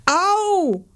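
high yelping ouch; howl of pain. Acted. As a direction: yowl it in sudden pain. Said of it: "jaulte es".

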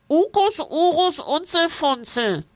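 high voice through the nose; nasal creature voice. A narrator's reading. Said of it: "Es spricht durch die Nase".

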